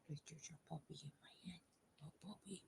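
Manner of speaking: breathy amused whisper to herself. Real, not acted. Crocheter whispering to herself over her own voice, amused whisper. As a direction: whisper it, laughing at yourself. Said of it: "talking like there's a puppy".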